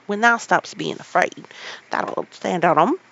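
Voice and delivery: loud, cheerful encouragement; cheery Yorkshire lad, loud; renewed cheer. Acted. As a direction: buck him up with cheer. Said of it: "with renewed cheer".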